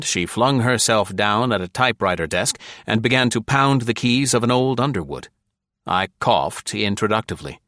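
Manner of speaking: plain reading voice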